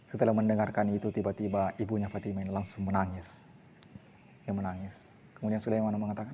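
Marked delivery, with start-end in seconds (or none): none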